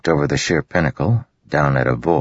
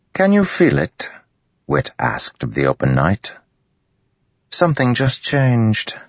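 higher, sly amused musing; wry Wit; 7 semitones higher than usual. Acted. Wit talking to the night, wry and amused. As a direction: savour the joke to the night.

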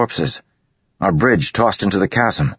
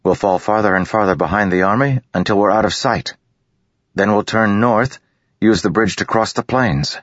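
raised, eager planning; 8 semitones higher than usual. A narrator's reading. Kaladin eagerly explaining the escape plan to the bridgemen. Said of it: "Kaladin said eagerly".

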